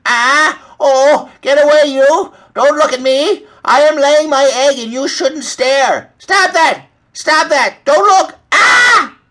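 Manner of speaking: high, loud, flustered hen; shrieking embarrassed hen; panicked squawking. A narrator's reading. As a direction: squawk in outrage at being watched. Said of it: "squawking at the top of her lungs".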